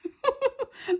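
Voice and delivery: high burst of giggles; real laugh at your own joke. Real, not acted. Cracking up at her own movie quote, laughing.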